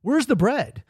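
entitled diner griping; demanding whine. Retold or imitated. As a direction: ask for the bread like a spoiled customer. Tech guys demanding bread.

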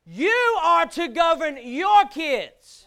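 loud, slow, forceful command; emphatic preaching. Real, not acted. Preacher commanding parents to govern their kids, emphatic. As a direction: raise your voice and space out every word.

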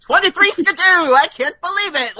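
high, hammed-up forties mid-Atlantic voice; mid-Atlantic accent. Joining the forties riff, mock mid-Atlantic accent. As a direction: play old-time mid-Atlantic amazement. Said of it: "a mid-Atlantic, you know, like, forties sort of".

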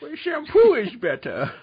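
high, loud, slow cartoon whine; goofy Sandler voice. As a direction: ham up a dopey Sandler line. Sandler impression quote.

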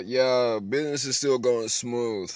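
normal talking voice

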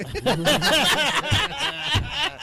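high, loud burst of laughter. Real, not acted. Friends laughing at the story, loud high laugh. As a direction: crack up loudly.

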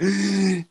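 loud high gasp for breath; gasping for air. Retold or imitated. Runner bent over his knees after the ninth rep, loud gasp. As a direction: suck in air like after sprints. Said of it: "just gasping for air".

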